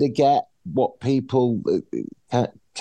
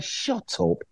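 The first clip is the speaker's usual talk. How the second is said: breathy, much softer throwaway shut up; muttered shut up; 13 semitones higher, 6 dB quieter than usual. Retold or imitated. Brushing off Twitter critics, under the breath. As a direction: drop it to a dismissive under-the-breath aside.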